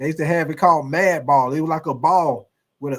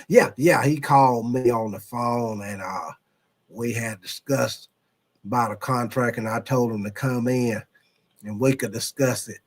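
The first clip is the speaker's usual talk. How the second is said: Jerry Jones impression; 5 semitones lower than usual. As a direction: play it like an unbothered old owner. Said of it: "a very good Jerry impression".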